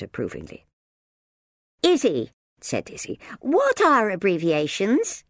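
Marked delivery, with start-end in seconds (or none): 1.8-2.3 put-on bright young girl. 3.4-5.2 put-on curious young girl. Girl asking.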